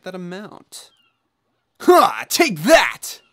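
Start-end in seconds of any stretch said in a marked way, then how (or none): 1.8-3.2 gloating shout. Real, not acted. Gloating over killing birds.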